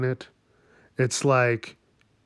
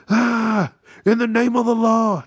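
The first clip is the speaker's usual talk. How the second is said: high, louder hammed-up lawman's proclamation; mock heroic cop; 9 semitones higher, 5 dB louder than usual. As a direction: play a pompous vigilante cop declaring justice. Mocking a vigilante cop.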